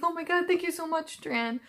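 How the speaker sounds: high, quick, hammed-up gratitude; mock gushing thanks. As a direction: gush in a high voice, parodying melodrama. Mocking imagined gushing thanks from Daniel.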